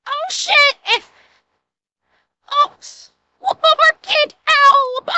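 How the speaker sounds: high, slow, cracking up; laughing high. Real, not acted. Host voicing the panicked gunner, laughing in a high voice. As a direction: squeal it through the laughter.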